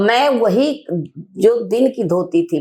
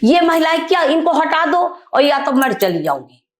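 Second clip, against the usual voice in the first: higher, heated ultimatum; retold angry ultimatum; 7 semitones higher than usual. To the meeting chairman about the women, heated ultimatum. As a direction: insist the women leave or you go. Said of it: "बार बार मैं वही कहती थी".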